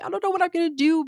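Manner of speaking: high, rushed mock-tearful whine; retold crying. Daughter crying on the phone with mom, imitated high whine. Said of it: "I start crying".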